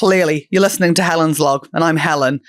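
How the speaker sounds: loud, upbeat promo voice; podcast ad read. Acted. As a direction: sell the Patreon with energy.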